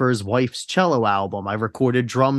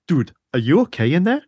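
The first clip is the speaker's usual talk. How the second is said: put-on German accent; 3 semitones higher, faster than usual. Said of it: "he has a thick German accent".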